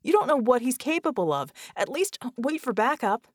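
angry warning. Acted. Red pleading angrily.

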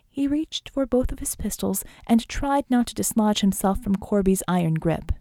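plain reading voice

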